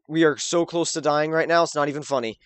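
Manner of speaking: ordinary talk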